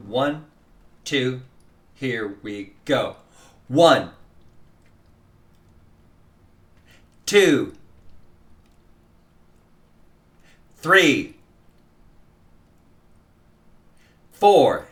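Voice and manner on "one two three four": each downbeat said out loud, alone; only the downbeats. Shown as a demonstration. Teacher calling only the first beat aloud. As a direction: call each beat number with silence between. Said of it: "say this first number out loud".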